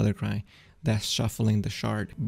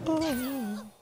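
high, slow, drawn-out theatrical wail; mock tragic lament; 14 semitones higher, much slower than usual. Player mourning bad luck in a card game, mock tragic lament.

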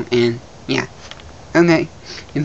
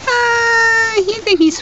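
high squeaky voice; much slower than usual. Acted. Squeaky voice continues.

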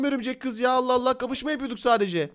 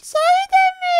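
put-on squeaky high-pitched spider girl, slow and sweet; girly high spider girl voice; 5 dB louder than usual. (çok ince sesli örümcek kız)

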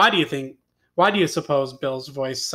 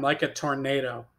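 usual speaking voice

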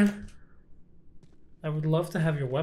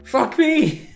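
startled curse; 9 semitones higher, 6 dB louder than usual. Real, not acted. Startled by enemy.

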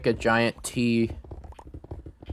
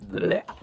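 retching noise; 9 semitones higher than usual. Retold or imitated. Mimicking shulker boxes throwing up.

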